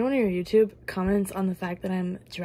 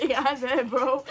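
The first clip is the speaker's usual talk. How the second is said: laughing; 5 semitones higher, slower than usual. Real, not acted.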